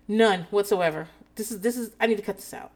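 ordinary talk

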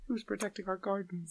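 half-volume cry pitched well up; Mitchell Musso. Retold or imitated. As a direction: mimic a bystander's high voice, held back. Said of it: "that's his additional voice just going".

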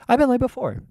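high squeaky admission; silly high aside. Pretend. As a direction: jump up into a comic falsetto.

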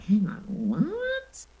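slow, high, drawn-out disbelief; stunned disbelief. Retold or imitated. Co-host recalling her shock at the comparison, drawn-out disbelief. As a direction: stop short as if you misheard.

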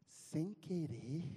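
drawn-out slow threat; hint of a tough guy's warning; menacing. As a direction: let the question hang, dangerous. Corró confronts the bully.

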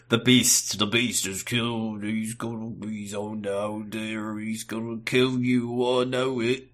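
slow, trailing, hushed doom-saying; ominous old man raving. Retold or imitated. Ruben warning that the Beast will kill, slow and hushed.

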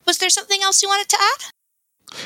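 high, light fairy voice. Acted. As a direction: lift the voice up small and bright.

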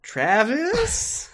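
high mock-unsure Sora; fumbling names. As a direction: grope for names you forgot.

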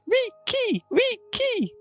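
put-on very high little girl's call; a little girl calling out to Riki, very high. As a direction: squeal the name like a small girl. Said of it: "a little girl's going".

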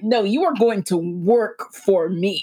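slow, weighty "work for me"; emphatic retold insistence. Son making his father work, imitated firm insistence. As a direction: lean slowly on each word, especially "work".

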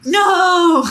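high, loud cry of refusal; loud protest. Acted. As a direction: burst out in mock outrage. Acted protest in quarrel.

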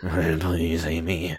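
low, soft mock plea; creepy pleading boyfriend. Boyfriend begs his girlfriend, low mock pleading. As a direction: beg low and hushed, hammed-up.

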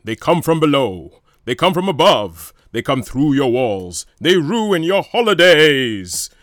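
booming, slow 1950s trailer voiceover; overly dramatic voiceover guy, loud and drawn out; movie trailer announcer. Acted. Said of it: "my nineteen fifties movie trailer announcer guy".

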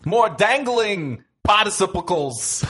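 Jerry Lewis. Retold or imitated. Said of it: "why are you Jerry Lewis all of a sudden?".

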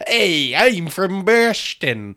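slow, loud, hammed-up Boston accent. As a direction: drag out a bad Boston accent. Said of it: "that's not a good Boston accent".

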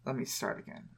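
whispered aside to self; quiet aside. Real, not acted. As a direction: mutter it under your breath. After muting phone, aside.

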